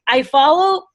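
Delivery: usual speaking voice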